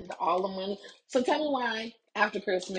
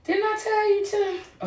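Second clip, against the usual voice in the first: much higher, sharp mock scold; mom scolding. Mom scolding son over undone chores, higher sharp scold. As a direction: call him out with a snap. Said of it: "right there".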